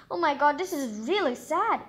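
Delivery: plain reading voice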